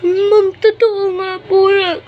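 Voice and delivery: put-on sob to the teacher, slow; fake crying. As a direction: whimper it like a fake tearful tattletale. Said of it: "mag-acting ka ba?". (kunwaring umiiyak na pagsusumbong kay ma'am)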